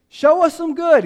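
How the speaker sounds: loud, high-pitched cry; crowd's demand. Acted. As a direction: call out as a desperate people. Voicing the people's cry to God.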